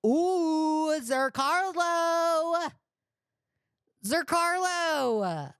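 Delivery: high, slow, drawn-out delight on the name; hyper excitement. Real, not acted. Streamer gleefully savoring the name Zercarlo, drawn out. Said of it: "I'm a little bit hyper".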